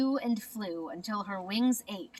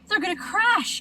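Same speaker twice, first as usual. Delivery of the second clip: higher, alarmed gasp; 11 semitones higher, 5 dB louder than usual. A narrator's reading. Stellaluna gasping as friends fall, higher and alarmed. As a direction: catch your breath in alarm. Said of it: "gasped Stellaluna".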